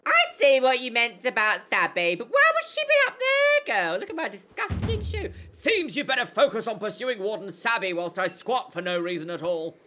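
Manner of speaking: loud, high Essex squawk; Essex accent. Acted. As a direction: belt it out in broad Essex. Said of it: "Dulcet Essex tones".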